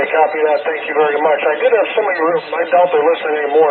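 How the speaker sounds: ordinary delivery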